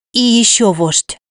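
wheezed through a covered mouth; hand over mouth. A narrator's reading. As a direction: rasp it behind your palm. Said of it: "Прикрыв рот ладонью, просипела".

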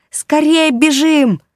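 high, loud, slow panicked cry; panicked shout. Acted. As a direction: scream at him to run. Fleeing attacking moths.

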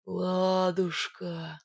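slow, dreamy lovestruck murmur; affectionate dazed drawl; fond murmur. A narrator's reading. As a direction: draw it out tenderly, as if entranced. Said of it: "ласково пробормотал".

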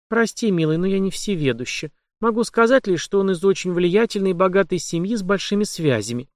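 put-on young woman voice. Heroine's voice.

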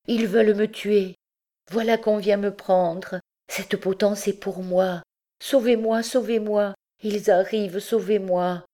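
high, panicked begging; frantic plea. Acted. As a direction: plead to be saved. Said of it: "la jeune fille éperdue". (supplication affolée, voix aiguë)

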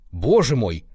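loud, startled cry; shocked exclamation. A narrator's reading. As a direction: exclaim it aghast. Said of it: "воскликнул Руневский".

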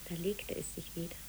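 soft whisper. Acted. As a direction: whisper it low, as it settles. Children telling secrets at bedtime.

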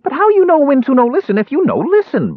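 high, loud dwarf outburst; angry demand. A narrator's reading. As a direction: demand it in a squeaky rage. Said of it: "Bupu demanded angrily".

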